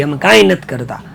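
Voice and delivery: usual speaking voice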